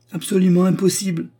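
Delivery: high, loud dismissal; outraged high-pitched agreement; indignant refusal. A narrator's reading. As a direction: rule it out emphatically. Baroness backs the indignant protest.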